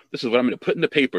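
rushed, eager announcement; fast and proud. Retold or imitated. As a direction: blurt it out proudly. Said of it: "She's like".

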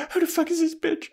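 very high-pitched comic indignation; mock jealous outrage. Girlfriend spots Angel with another woman, high mock outrage. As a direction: squeak it in hammed-up disbelief. Acted jealous reaction to rival.

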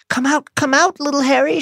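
put-on high, sing-song taunting baby talk; mock baby voice. A narrator's reading. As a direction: coo it at him like mocking a toddler. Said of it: "she called in her mock baby voice".

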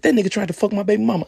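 high, loud, fast insistence; Darius's voice. Retold or imitated. Darius insisting to the narrator, high, loud and fast. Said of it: "He said".

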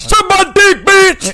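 high furious yell; angry shout. Retold or imitated. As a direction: scream the insult. Voicing Vetter cursing ref.

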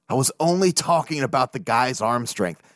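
normal talking voice

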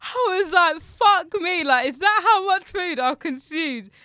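high, very loud disbelief; reliving the shock at full volume; hammed-up horrified exclaiming. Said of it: "it shocked me".

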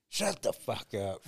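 usual speaking voice

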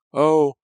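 high, loud "oh" of effort; acted high-pitched groan; loud cry mid-struggle. A narrator's reading. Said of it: "Pam groaned".

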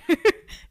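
short laugh. Real, not acted. Laughs at own wish.

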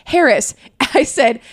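usual speaking voice

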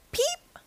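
tiny, soft, very high peep; little rising cheep; chick peep. A narrator's reading. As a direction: squeak like a baby chick. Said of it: "her chick said".

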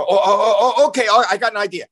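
mock panic trying to sound in control; panicked. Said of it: "when I'm panicked".

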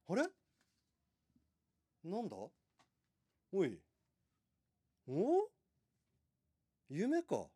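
startled, high bewildered questions; confused waking. Acted. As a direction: wake up baffled.

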